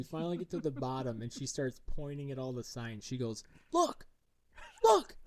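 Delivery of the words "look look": two very high, loud cries, the second even higher; a little girl shrieking to make dad see; excited kid shout. Retold or imitated. Daughter shows the bar signs.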